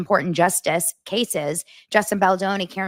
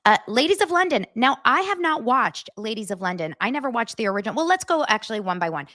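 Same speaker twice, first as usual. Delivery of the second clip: ordinary talk, nothing marked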